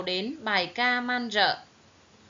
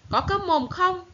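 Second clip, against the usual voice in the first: higher, louder snapped demand; narrated shout, pitched up and forceful; angry yell; 8 semitones higher, 5 dB louder than usual. A narrator's reading. Pirate captain bellowing at the doctor, angry yell. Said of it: "trừng mắt nhìn bác sĩ quát".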